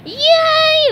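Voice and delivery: very high, loud squeal; high-pitched gleeful yell; little fishes cheering. A narrator's reading. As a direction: cheer like a crowd of small kids. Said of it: "the little fishes replied".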